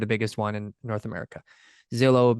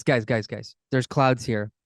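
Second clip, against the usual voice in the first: usual speaking voice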